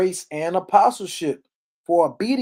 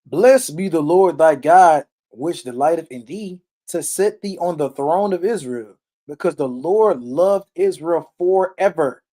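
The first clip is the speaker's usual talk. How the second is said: slow, louder scripture read with weight; 5 dB louder than usual. Acted. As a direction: proclaim the verse slowly. Reads scripture aloud with weight.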